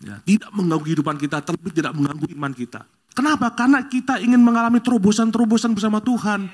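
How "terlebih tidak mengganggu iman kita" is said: ordinary talk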